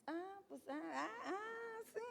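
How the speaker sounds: high-pitched, drawn-out "ah" of mock indignation; mock spiteful comeback. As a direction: play an offended wife, stretching it slowly. Mimics a spiteful reaction to mistreatment.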